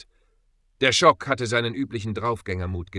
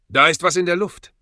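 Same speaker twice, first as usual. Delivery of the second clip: higher, louder cry of alarm; sudden alert; 9 semitones higher, 10 dB louder than usual. A narrator's reading. Peter warns the other mercenaries in the cave, suddenly alert. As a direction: call out that something is off.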